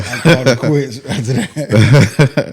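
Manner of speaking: laughing. Real, not acted. Guest laughs telling school story.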